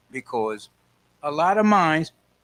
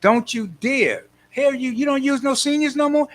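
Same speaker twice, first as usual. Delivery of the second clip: indignant warning; 10 semitones higher, 5 dB louder than usual. Retold or imitated. Seniors warning Washington about no work, indignant shout. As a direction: tell Washington off, half playful. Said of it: "we're gonna be telling Washington".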